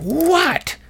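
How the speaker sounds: sharp high-pitched mock yell of "What?"; nurse's yell. Annoyed night nurse yelling "What?" at her patient, high mock yell. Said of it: "Literally yelled at me".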